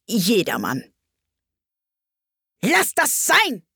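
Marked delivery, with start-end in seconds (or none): 2.6-3.6 high, drawn-out angry order; irate queen's outburst; heated. A narrator's reading. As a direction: scold slowly in a high queenly voice. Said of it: "eiferte die Königin".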